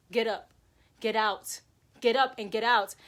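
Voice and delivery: loud, punchy repeated command; urgent warning. Retold or imitated. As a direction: urge them up and out, forcefully. Voices urgent inner warning.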